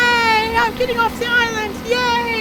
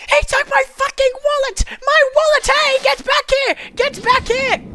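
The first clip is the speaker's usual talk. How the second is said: higher, outraged shouting after thief; angry yell; 6 semitones higher than usual. Acted. Wallet stolen, yelling.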